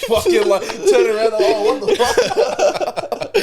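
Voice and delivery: loud, high, laughing retell. Real, not acted. Son telling about the cops, laughing loudly. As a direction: crack up while telling it.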